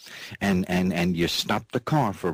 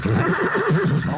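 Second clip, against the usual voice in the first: high giggle; 5 dB louder than usual. Real, not acted. Giggle between lines.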